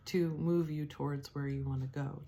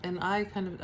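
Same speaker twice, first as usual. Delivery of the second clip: ordinary delivery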